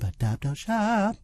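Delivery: high sing-song slogan. Real, not acted. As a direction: chant "adopt, don't shop" up high and drawn out. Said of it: "echoing the slogan".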